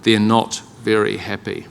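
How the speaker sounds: low, soft deadpan Kiwi understatement; flat-toned Kiwi shrug of a line; classic Kiwi understatement. Retold or imitated. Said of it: "so classic Kiwi".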